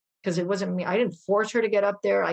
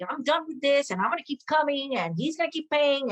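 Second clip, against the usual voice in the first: pitched-up sassy retort, tossed off; 6 semitones higher than usual. Retold or imitated. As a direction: fling it back with attitude, voice up high. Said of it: "sassy mood".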